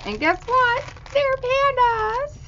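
high, slow squeal of delight; excited squeal. Real, not acted. Opening a swap package, squealing with delight. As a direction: squeal the reveal with joy. Excited reveal of panda cards.

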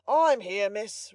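eager butler. A narrator's reading. Butler answering the young lady upstairs, eager call. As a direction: answer promptly and importantly. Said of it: "replied Mister Giles".

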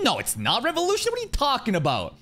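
mock outraged commenter. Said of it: "It's like".

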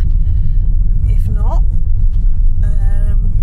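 hushed unvoiced whisper. Real, not acted. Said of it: "Are you whispering?".

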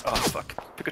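high breathy startled gasp; startled whisper. Real, not acted. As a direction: caught off guard, hushed. Ambushed, sudden fright.

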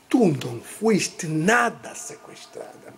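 low, unimpressed mock-mother voice; imitated stern mother. Mother refusing to believe her daughter's tale, imitated stern voice. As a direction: drop low and shut the excuse down.

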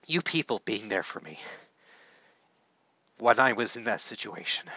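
fighting back tears as he thanks them; choked up. Real, not acted. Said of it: "getting this emotional on camera".

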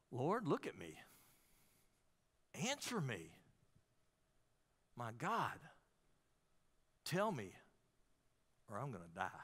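hushed, low, halting plea; pleading with God. Acted. David struggling and begging God for an answer, hushed pleading. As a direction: softly voice a desperate prayer, phrase by phrase. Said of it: "he's pleading with God".

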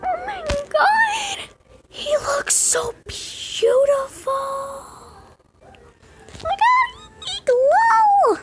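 very high, slow squeal of joy; delighted squeal. Real, not acted. Gamer squealing at her glowing buffalo, very high squeal. Pet revealed, overjoyed.

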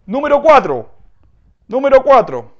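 loud, bright announcement of the next item; announcing. Acted. Teacher announcing the next example to students. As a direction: call out the number to the class.

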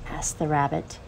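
ordinary delivery, nothing marked

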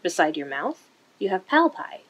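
usual speaking voice

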